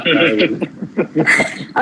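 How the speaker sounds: real chuckle in a short run; amused laugh. Real, not acted. Teacher amused by a student's cow comparison, light laugh. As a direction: laugh lightly without words.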